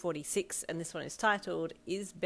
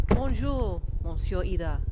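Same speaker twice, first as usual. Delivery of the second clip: French valet's greeting, bonjour pitched up; French accent; 3 semitones higher, 11 dB louder, slower than usual. A narrator's reading. Valet driver greets arriving passenger, French accent. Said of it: "in his gorgeous accent".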